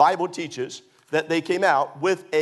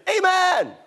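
high, loud congregational "amen!"; shouted amen; 14 semitones higher, 5 dB louder than usual. Acted. Preacher imitating happy fellows in church, shouted amen. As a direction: call it out like a happy worshipper. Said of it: "and they'll say".

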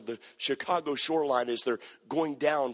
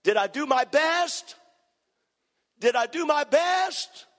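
much higher and louder, slow and drawn out; desperate, pleading outcry; anguished scream; 12 semitones higher, 4 dB louder, slower than usual. Retold or imitated. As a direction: cry it out like a man haunted by his nightmares. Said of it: "I find myself screaming".

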